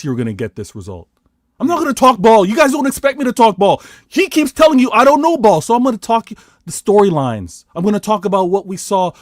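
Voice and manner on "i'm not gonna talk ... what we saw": loud, high-pitched protest; worked-up, raised-voice insistence; indignant rant. Real, not acted. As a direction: fire back at your critics. Indignant rant at his critics.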